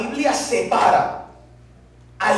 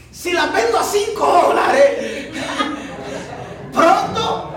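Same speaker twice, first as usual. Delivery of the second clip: laughing boast; 4 semitones higher, slower than usual. Retold or imitated. Gloating over big profit.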